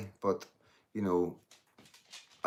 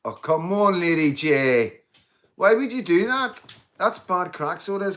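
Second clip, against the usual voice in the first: mock outrage; 9 semitones higher, 9 dB louder than usual. Real, not acted. Mock outrage at a viewer comment.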